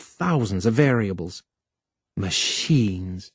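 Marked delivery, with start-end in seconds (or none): 2.2-3.3 low, soft, dismissive scoff; scornful. Acted. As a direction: sneer at the very word. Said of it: "She said it with scorn".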